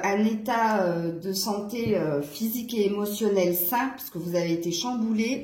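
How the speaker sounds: ordinary talk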